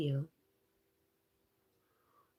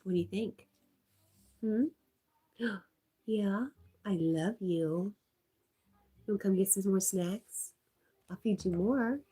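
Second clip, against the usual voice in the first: pet talk; 5 semitones higher than usual. Real, not acted. Talking to her dog.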